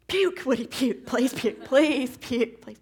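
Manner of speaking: desperate coaxing call; pleading yell at dog. Retold or imitated. Begging dog to vomit in yard.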